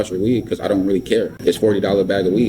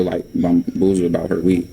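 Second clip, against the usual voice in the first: usual speaking voice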